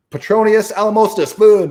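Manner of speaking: loud, high hammed-up wizard chant; mock spell incantation. Mocking wand-waving magic to the listener, hammed-up loud incantation. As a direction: cast a silly fake spell with gusto.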